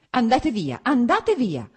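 high, loud, sharp cry; cutting shout. A narrator's reading. Wife ordering husband away, shouting cuttingly. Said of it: "gridò con voce ancora più tagliente".

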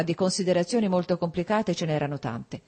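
plain reading voice

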